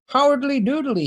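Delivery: high cheery Ned Flanders voice; chirpy neighbourly howdy. Acted. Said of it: "he says sleepily".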